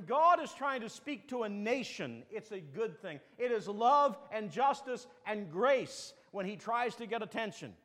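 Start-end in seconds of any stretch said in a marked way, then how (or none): none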